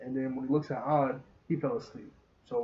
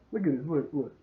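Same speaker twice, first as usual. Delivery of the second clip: ordinary talk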